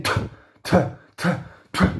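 crisp voiceless t t t t, lips closing; unvoiced t clicks. Shown as a demonstration. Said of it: "It's like saying".

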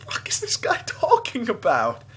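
high, incredulous question breaking into a laugh; baffled outburst. Retold or imitated. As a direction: re-enact being utterly bewildered. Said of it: "and I'm like".

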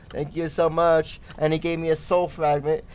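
ordinary talk

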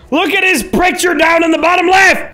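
excited shout. Real, not acted. Shouting at the screen.

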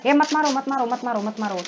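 high, loud, rapid-fire plea; urgent shouting. Real, not acted. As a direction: call out in alarm to stop them. Pleading with attackers mid-game. (घबराकर ऊँची आवाज़ में चिल्लाना)

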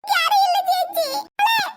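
tearful, panicked calling; frantic mother. Acted. Mother calling for her lost daughter, frantic. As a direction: cry out desperately for the child. Mother calls for missing child.

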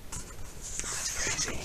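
slow soft whisper. Real, not acted. Parent whispering an aside to the child. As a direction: hush it out slowly.

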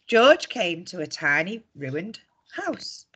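ordinary delivery

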